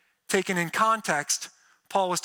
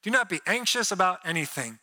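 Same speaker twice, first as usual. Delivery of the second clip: normal talking voice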